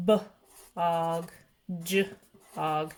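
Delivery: "b, og, j, og" with a gap between first sound and ending; sounded-out rhymes. Shown as a demonstration. Teacher modelling new rhyming words, sounded out in parts. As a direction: split "b, og, j, og" into onset and rhyme. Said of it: "the rhyming part of that word is".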